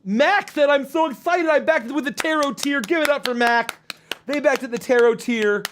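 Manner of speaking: high, hyped-up backer announcement; rally the audience to cheer; excited shout-out. Real, not acted.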